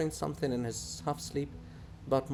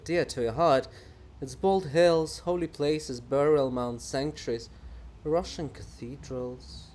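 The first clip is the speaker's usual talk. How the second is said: ordinary talk, nothing marked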